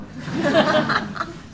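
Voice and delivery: high breathy laugh. Real, not acted. Laughing at himself.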